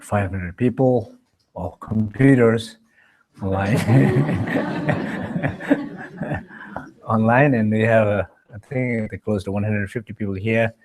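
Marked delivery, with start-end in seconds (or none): 3.8-6.8 long breathy chuckle; amused laugh. Real, not acted. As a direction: chuckle at your own small joke. Joke about people or computers online.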